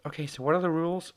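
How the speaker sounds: high-pitched clueless imitation; mock ditzy question. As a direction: ask it high, playing ditzy. Acting out clueless Alicia's question.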